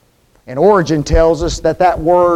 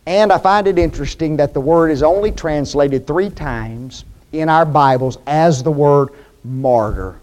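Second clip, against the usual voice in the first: usual speaking voice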